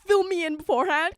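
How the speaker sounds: loud, high-pitched mock telling-off; exasperated scolding. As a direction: plead with your friend in hammed-up exasperation. Re-enacting scolding her friend that night.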